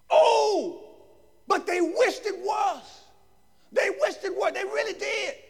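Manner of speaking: high, emphatic exclaiming preacher; full-force preaching. Real, not acted. Preacher revealing what the questioners wished, high emphatic preaching. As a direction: cry it out high to the congregation.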